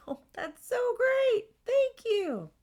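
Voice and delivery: high, loud mock-gushing; fake enthusiasm. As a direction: gush with exaggerated, phony delight. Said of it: "agree enthusiastically to whatever they argue".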